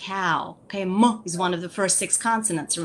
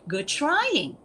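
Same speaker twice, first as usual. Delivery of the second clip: put-on praising a child; 7 semitones higher than usual. Shown as a demonstration. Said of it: "you gotta praise them again".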